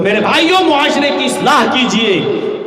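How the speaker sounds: loud, high sermon, hammering each point; fiery preaching. Acted. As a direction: preach it hard to the crowd. Closing exhortation. (پرجوش خطابت)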